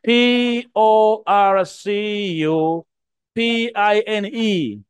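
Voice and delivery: P O R C U P I N E spelled letter by letter, slowly. Shown as a demonstration. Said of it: "I said a porcupine".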